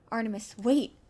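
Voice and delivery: loud plea to stop; calling after. Acted. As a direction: call out after someone leaving. Friend calls after him.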